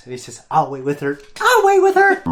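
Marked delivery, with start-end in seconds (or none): 1.4-2.2 very high, loud imitated shout; retold dad yell. Dad insisting he'll wait with her, imitated yell. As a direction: blow up as a yelling dad. Dad's line repeated as a yell.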